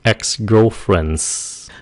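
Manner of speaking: put-on ex-girlfriends stretched very slowly with the final s; slow plural drill. Shown as a demonstration. Teacher slowly stresses the plural ex-girlfriends. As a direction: draw out ex-girlfriends and hiss the s. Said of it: "số nhiều".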